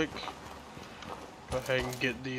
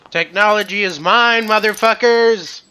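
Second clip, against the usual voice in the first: high, loud gloating yell; triumphant shout; 7 semitones higher, 11 dB louder than usual. Pretend. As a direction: crow it like a mock villain, stretching the last word. Gloats over seizing the technology.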